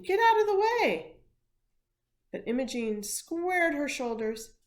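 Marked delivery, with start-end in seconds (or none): 0.0-1.2 loud, high panicked shout; father yelling in alarm. A narrator's reading. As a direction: shout a warning at his daughter. Father running to stop her.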